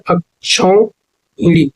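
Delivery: अक्षौहिणी very slowly, अक्, क्षौ, then हिणी; broken into parts. Shown as a demonstration. Teacher confirming the right spelling, अक्षौहिणी slowly in syllables. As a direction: stretch अक्षौहिणी part by part. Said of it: "ये शुद्ध वर्तनी है".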